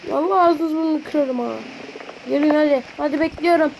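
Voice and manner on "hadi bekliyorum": ordinary delivery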